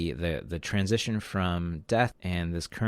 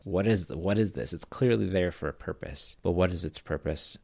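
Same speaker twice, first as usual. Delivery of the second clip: ordinary talk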